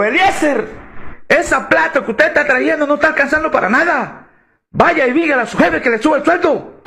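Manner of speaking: high-pitched mock scolding; hammed-up wifely demand; imitated nagging wife. Imitating nagging wife giving orders.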